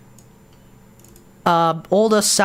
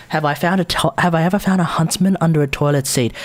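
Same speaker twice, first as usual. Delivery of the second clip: normal talking voice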